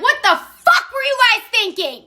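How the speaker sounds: high mock-angry scolding; furious mom. Scary mom cussing out the girls, angry. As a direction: act out a mom ripping into the kids. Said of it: "ripping us a new one, cussing us out".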